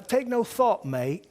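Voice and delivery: high, loud mock-English cheer, dropping on mate; mock English accent. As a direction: encourage a pal in a put-on English accent. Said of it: "this is how the English used to encourage".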